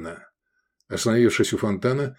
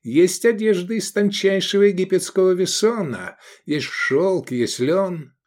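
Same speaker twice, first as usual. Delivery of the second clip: higher-pitched, slightly affronted sales pitch; offended merchant; 9 semitones higher than usual. A narrator's reading. Merchant listing fabrics to a customer, offended. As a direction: reel off the wares, mildly offended. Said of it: "оскорбился торговец".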